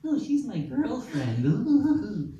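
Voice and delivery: high, drawn-out mock swooning singsong; sophomoric teasing. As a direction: tease like a kid about someone's crush. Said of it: "that sophomoric bullshit of".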